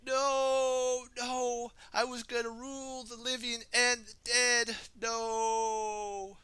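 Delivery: very high, loud anguished cry; despairing wail. Acted. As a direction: cry out as you're destroyed. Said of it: "Samuku wailed".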